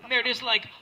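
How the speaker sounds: ordinary delivery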